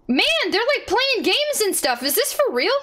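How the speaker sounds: high, excited squeal; incredulous and thrilled; amazed delight. Real, not acted. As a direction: marvel in a giddy high voice. Amazed at cats playing games.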